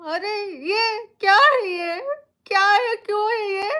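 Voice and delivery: breaking down in tears; real anguished crying. Real, not acted. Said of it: "मैं तीन घंटे से रो रही हूँ".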